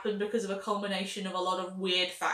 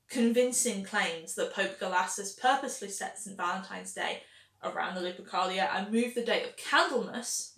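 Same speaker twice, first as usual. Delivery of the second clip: ordinary delivery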